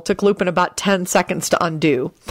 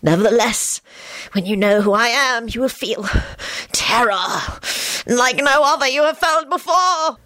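sinister skull voice climbing higher; theatrical villain menace; menacing hiss; 6 semitones higher than usual. A narrator's reading. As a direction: threaten eerily, building to a high finish. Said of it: "it hissed again".

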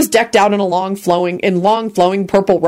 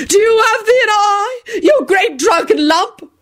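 drawn-out, very high tirade; angry screech; slower than usual. A narrator's reading. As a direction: shriek the insult indignantly. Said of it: "screeched back angrily".

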